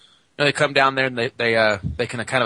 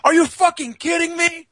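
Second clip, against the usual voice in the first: high yelled disbelief; retold shout; 3 dB louder than usual. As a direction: blurt it out in a high shocked shout. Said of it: "He goes".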